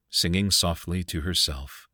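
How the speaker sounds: usual speaking voice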